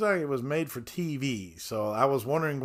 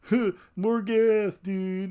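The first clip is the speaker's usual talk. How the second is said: dopey stoner voice; 8 semitones higher, 4 dB louder, much slower than usual. Retold or imitated. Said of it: "And he's".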